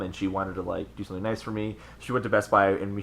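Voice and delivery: ordinary talk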